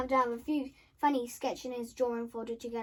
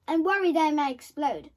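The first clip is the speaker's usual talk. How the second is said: usual speaking voice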